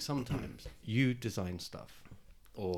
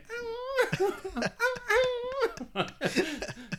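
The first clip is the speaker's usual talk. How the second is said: very high, loud wordless wail; comic high keening, cracking up; mock voice noise; 6 dB louder than usual. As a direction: imitate someone as a long high-pitched whine. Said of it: "Jeff, I just hear".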